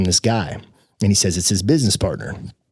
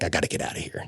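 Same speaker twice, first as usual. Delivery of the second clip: low, hushed thought to himself; inward muttering; 5 semitones lower, 10 dB quieter, faster than usual. Retold or imitated. Thinking to himself at breakfast, hushed retold thought. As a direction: say it under your breath, low and soft.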